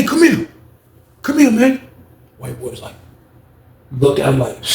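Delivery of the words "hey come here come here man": high-pitched imitated call; calling him over. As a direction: retell a summons called across the room. Booker calls the man over.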